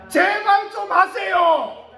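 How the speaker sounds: slow, loud, high urging shout; urgent shout. Real, not acted. Preacher urging the congregation, slow pleading shout. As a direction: plead hard with them to do it.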